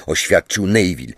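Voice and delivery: ordinary delivery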